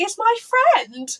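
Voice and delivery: high, sweet innocent voice; childlike innocent. Retold or imitated. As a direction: squeak it like a happy child. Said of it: "And I was like".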